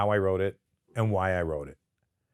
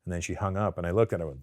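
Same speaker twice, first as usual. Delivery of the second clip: usual speaking voice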